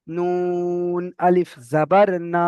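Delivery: নুন আলিফ জাবার না, very slow with না drawn out; long না reading. Shown as a demonstration. Teacher to students, drawing out the vowel. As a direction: stretch না one alif. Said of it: "এখানে এক আলিফ টান হবে".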